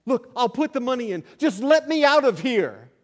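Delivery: high, loud pleading to be let out; panicky plea. Retold or imitated. Trapped caller pleading with the operator, loud and high. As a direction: beg desperately, voice climbing.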